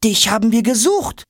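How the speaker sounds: very high, eager little voice; eager little character. Acted. Traveller greeting the flying suitcase, high and eager. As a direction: pipe up high and keen. Small character calls out excitedly.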